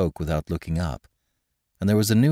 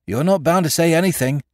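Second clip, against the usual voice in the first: much higher, louder, busybody eagerness; officious excitement; 11 semitones higher, 5 dB louder than usual. A narrator's reading. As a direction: butt in, pitched up and eager. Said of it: "in officious excitement".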